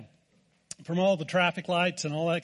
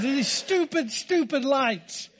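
high, drawn-out mock tantrum; retold frustration; 7 semitones higher, much slower than usual. Husband repeating his rant to his wife, mock frustrated. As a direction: hammer each word in hammed-up exasperation.

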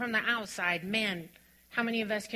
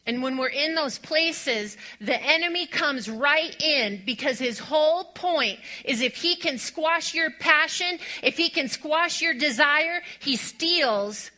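loud, higher passionate preacher's build; fervent preaching; 6 semitones higher, 6 dB louder than usual. Acted. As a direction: drive the point home with fire. Preacher builds to full force.

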